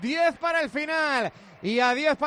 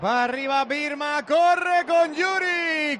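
louder, excited radio commentary; play-by-play; 4 semitones higher, 4 dB louder than usual. Acted. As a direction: call the run as it happens. Live play-by-play of an attack.

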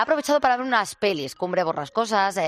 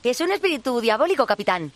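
higher, eager bumbling first mate; fawning pirate sidekick voice; 5 semitones higher than usual. Acted. First mate answers, frightened.

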